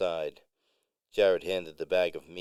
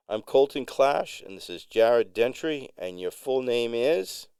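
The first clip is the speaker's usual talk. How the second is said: lively, higher-pitched introductions; animated; 6 semitones higher, 5 dB louder than usual. A narrator's reading. Musician introducing himself and his friend, animated. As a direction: bounce through the names, lifting into a question. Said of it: "Colton expressed himself animatedly".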